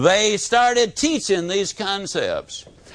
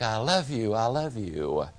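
echo; 7 semitones lower, 8 dB quieter than usual. A narrator's reading. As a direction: lower and much softer, fading like a far echo. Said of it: "the echo came back".

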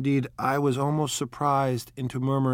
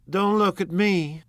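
high, loud, drawn-out comeback; slow, emphatic protest; retort; 9 semitones higher, 5 dB louder than usual. A narrator's reading. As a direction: throw it back, refusing the blame. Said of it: "Daisy retorted".

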